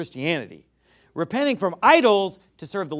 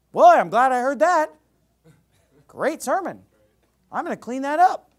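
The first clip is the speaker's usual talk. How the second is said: pleased churchgoer; 7 semitones higher, 7 dB louder than usual. Retold or imitated. Imagined cheerful listener after sermon.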